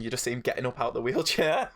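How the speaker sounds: usual speaking voice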